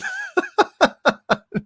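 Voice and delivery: high-pitched giggling laugh; amused high cackle. Real, not acted. Laughs at viewer's question.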